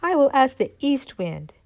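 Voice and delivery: decide brightly to try again; determined little acorn. Acted. Acorn deciding to ask the east wind, resolved. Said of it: "The acorn said".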